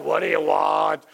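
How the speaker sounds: mild mock yell, slowed down; grumpy dad calling through the door, drawn out; half-hearted imitation of a yelling father; father's yell. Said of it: "my father would yell from the inside".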